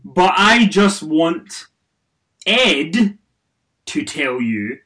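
slow, loud, deadpan word by word; reading a typo-filled tweet. Pretend. Host reading a fan's garbled tweet aloud, slow and loud. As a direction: read each word stiffly as misspelled. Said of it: "let's try one more time".

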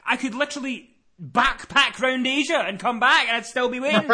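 high-pitched, worked-up complaint; exasperated rant. Retold or imitated. Caller exaggerating the long wait, high exasperated rant.

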